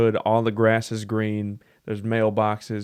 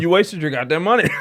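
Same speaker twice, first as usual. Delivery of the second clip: laughing; 6 semitones higher, 4 dB louder than usual. Real, not acted. Laughing at the cheap candy.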